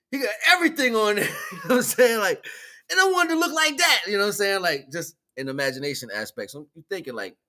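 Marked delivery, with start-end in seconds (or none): 0.1-4.0 fast words through laughter; laughing. Real, not acted. Speaker recalling Scooby-Doo's huge sandwich, laughing. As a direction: laugh while telling it.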